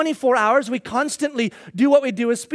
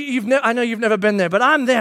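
ordinary talk

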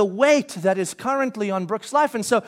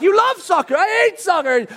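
higher, louder back-and-forth; raised quote; 9 semitones higher, 5 dB louder than usual. Retold or imitated. Parent arguing with kid over soccer: raised voice. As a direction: push back, pitched up and loud. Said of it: "And I'm like".

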